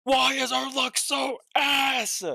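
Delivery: high, slow exasperated yell; frustrated shout. Real, not acted. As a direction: wail it up at the bad luck. Frustrated at bad luck.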